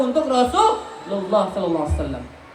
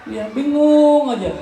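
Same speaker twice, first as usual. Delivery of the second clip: ordinary talk, nothing marked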